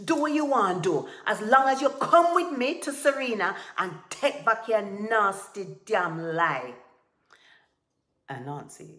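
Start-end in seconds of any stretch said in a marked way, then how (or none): 0.1-6.7 loud tirade slowing to a hard end; angry shout. A narrator's reading. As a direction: shout it impatiently, dragging the final words. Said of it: "Tiger shouted".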